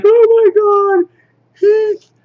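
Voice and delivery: very high, loud, drawn-out wail; slow falsetto cry of dismay; mock horrified cry. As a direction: shriek it in hammed-up shock. Shocked discovery, acted out.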